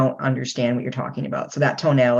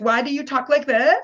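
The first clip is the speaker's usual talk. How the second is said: retold movie line; 10 semitones higher than usual. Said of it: "she uses, like, a Valley Girl tone".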